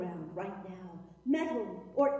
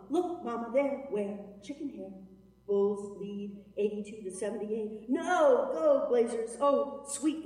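usual speaking voice

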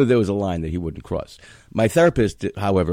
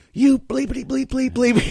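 much higher, louder mock cursing; angry son cursing; 4 dB louder than usual. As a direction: fling the bleeps like an outraged kid. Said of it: "he's like".

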